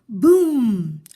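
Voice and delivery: story sound effect boom; loud boom. A narrator's reading. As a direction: slam the word like a crash.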